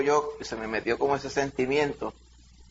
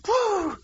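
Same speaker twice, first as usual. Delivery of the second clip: golpe imitado. Retold or imitated. Golpe de la puerta en la espalda.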